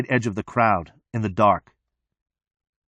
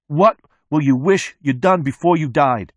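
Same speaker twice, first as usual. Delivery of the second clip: pressing question; 4 semitones higher, 3 dB louder than usual. A narrator's reading. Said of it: "he says".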